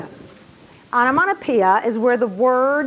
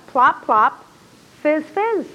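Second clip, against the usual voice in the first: slogan sound effect; 2 semitones higher, much slower than usual. Shown as a demonstration. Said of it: "slogan that sounds like the product".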